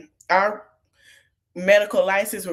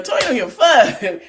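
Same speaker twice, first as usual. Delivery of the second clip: louder and higher, laughing through it; emphatic laughing outburst; 5 semitones higher, 6 dB louder than usual. Real, not acted. Vlogger laughing loudly about Toya not caring. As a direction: blurt it out with a laugh, raising the stakes.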